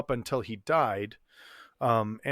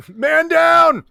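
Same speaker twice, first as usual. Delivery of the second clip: loud battlefield shout, pitched way up; 9 dB louder than usual. Pretend. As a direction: yell it like a soldier in combat. Mock battle cry, laughing.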